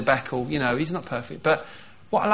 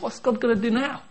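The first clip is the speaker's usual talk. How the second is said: put-on childlike wonder; 6 semitones higher than usual. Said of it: "that childlike sense of".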